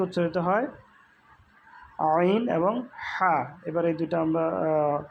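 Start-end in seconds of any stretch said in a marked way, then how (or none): 2.1-3.3 slow, pressed "আইন" and "হা"; squeezed throat letters. Shown as a demonstration. Teacher names the mid-throat letters, squeezed and slow. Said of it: "আওয়াজকে চাপাইয়া উচ্চারণ করতে হয়".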